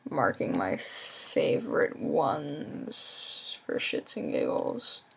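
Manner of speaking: slow, sleepy, barely voiced; tired. Real, not acted. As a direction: drag it out, half asleep. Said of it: "sorry, I'm so tired".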